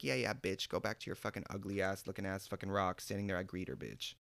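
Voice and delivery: low, quiet, fading put-down; dismissive aside. Retold or imitated. Sending the rival back to her post, dismissive aside. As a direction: drop low and soft, sinking to the last word.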